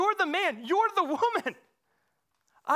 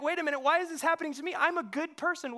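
fast, higher, indignant rush; indignant protest; 8 semitones higher, 4 dB louder, faster than usual. Retold or imitated. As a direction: blurt it out, offended.

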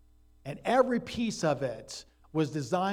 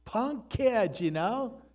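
a mild mock giant's scorn, higher and louder; sneering at the punk kid, lightly acted; a hint of an outraged giant; Goliath retold; 4 semitones higher, 4 dB louder than usual. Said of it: "And he's like".

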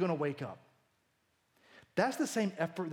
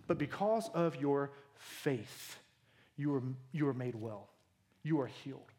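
softer and lower, gently telling her she is healed; tender; 5 semitones lower, 4 dB quieter than usual. Acted. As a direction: speak it softly like comforting a daughter. Jesus speaking tenderly to healed woman.

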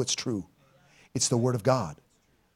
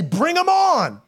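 defiant challenge; 10 semitones higher, 3 dB louder, slower than usual. Retold or imitated. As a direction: toss it out high and bold. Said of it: "you're gonna have to say".

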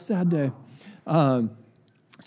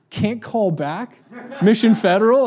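indignant disbelief; 7 semitones higher, 6 dB louder than usual. Retold or imitated. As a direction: ask it shocked and affronted. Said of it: "I'm like".

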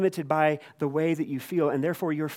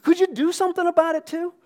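mock plea; 6 dB louder, faster than usual. Disciples pleading with empathizing Jesus.

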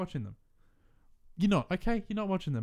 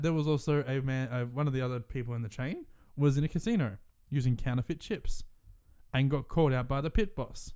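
ordinary delivery

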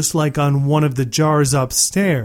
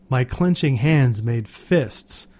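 ordinary delivery

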